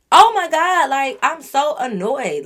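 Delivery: loud high "Oh my God", then slow; exasperated outburst. Real, not acted. Vlogger blowing up over the lies, loud then slow. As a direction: burst out, then drag the annoyance. Exploding in annoyance.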